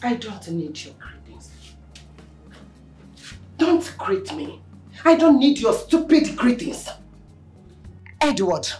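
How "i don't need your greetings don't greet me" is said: cold hiss. Acted. Angry mother refusing greeting.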